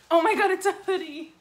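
loud, high-pitched delight; excited. Real, not acted. Vlogger discovering a hoodie in the box, excited. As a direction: gush loudly over a great find.

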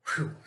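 short breathy "whew"; awed little puff of air; relieved exhale. Real, not acted. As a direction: let out a breath after a weighty reading. Exhales after long awesome reading.

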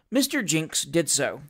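plain reading voice

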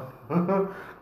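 short high burst of laughter. Real, not acted. Laugh after question.

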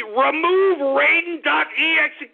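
exasperated repeat. Real, not acted. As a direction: say it again, losing patience. Repeats command, escalating.

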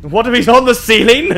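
high loud nervous laughter; scared laughing. Real, not acted. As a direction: laugh through real fright. Nervous laugh in horror game.

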